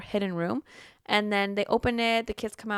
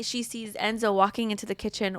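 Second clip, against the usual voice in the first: usual speaking voice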